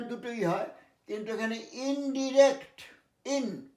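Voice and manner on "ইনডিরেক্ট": ইনডিরেক্ট with short i, clearly modelled; correct pronunciation. Shown as a demonstration. Teacher repeating the correct form for students. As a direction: repeat the right ইনডিরেক্ট firmly. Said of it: "কথাটা ইনডাইরেক্ট না".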